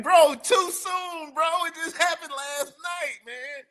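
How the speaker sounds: hammed-up squeaky too soon; mock high protest. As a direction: whine it up high in fake outrage.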